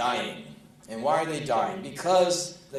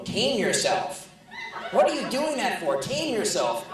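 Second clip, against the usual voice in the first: mock indignant fan; 7 semitones higher than usual. Mocking record buyers' complaint.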